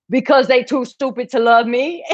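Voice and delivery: high, slurred dopey voice reading the comment; dumb guy voice. Retold or imitated. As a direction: read it like a clueless lovesick guy. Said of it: "this is how I heard it".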